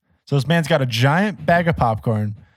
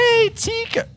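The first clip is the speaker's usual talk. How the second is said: very high-pitched mock British voice; squeaky, put-on British accent; hammed-up British in falsetto; slower than usual. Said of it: "Do it in a British accent".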